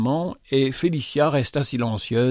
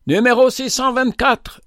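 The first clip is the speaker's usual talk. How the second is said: high, raised calling voice; cri d'appel; 10 semitones higher, 4 dB louder than usual. A narrator's reading. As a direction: call the number out over a crowd. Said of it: "cria un des hommes". (crier bien haut pour appeler)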